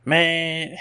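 high, loud Louisiana "man"; mock-indignant Louisiana exclamation; Louisiana guy. Podcaster posing as a Louisiana man, Louisiana accent. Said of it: "say you're doing a guy from Louisiana".

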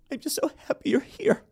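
high, breathy mock gush; gushing Drew Barrymore. Mocking Drew Barrymore greeting her guest, high gushing parody. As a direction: parody a host overflowing with feeling. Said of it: "Drew Barrymore is like".

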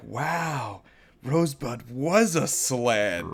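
mock amazement. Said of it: "You're gonna be like".

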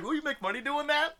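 mock question. Mocking people's reaction to photos.

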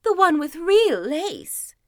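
lightly voiced, high and proud; a vain older sister showing off; boastful stepsister. Elder stepsister still boasting.

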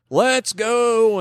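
high, drawn-out mock holler; mock yell. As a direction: call it out high as if across a church. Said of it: "other side of the church yells".

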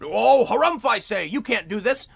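put-on harumphing bluster; mock harumphing Biden. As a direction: huff it out like a caricatured indignant politician. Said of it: "And Biden goes".

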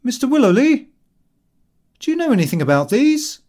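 high, loud call to a colleague. A narrator's reading. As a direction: call over for help, pitched well up. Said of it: "looked at them and called out".

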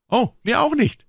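put-on very high, loud boy's voice; high boyish protest; eager chiming in. A narrator's reading. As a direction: jump in keenly as a boy, pitch way up. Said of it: "fiel Tom ein".

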